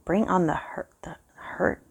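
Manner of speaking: soft, half-whispered inner thought; puzzling it out under the breath; thinking aloud. A narrator's reading. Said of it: "I thought to myself".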